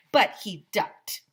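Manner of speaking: ordinary delivery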